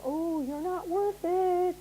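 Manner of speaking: little devil. Acted. Little devil on your shoulder, slowly taunting. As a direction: drag out the devil's put-down. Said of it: "that's the little devil saying".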